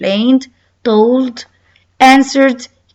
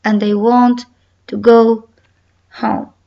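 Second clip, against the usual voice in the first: ordinary talk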